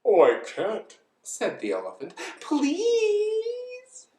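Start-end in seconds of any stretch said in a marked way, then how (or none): 0.1-1.0 loud, mild protest; reluctant elephant. Acted. Elephant declines to sit on egg, loud reluctant protest. 2.4-4.1 very high, stretched-out plea; begging. Acted. Bird begs the elephant, long high plea. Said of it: "begged the bird".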